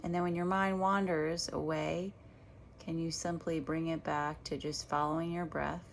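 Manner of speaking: unhurried meditation guide with long pauses; guided meditation. Real, not acted. Meditation teacher guiding listeners back to their breath, guided meditation voice. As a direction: lead them gently through each breath, leaving space between phrases.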